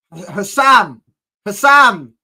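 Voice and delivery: loud taunting call of Hassan. Real, not acted. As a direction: call his name out mockingly. Calling out a rival fan.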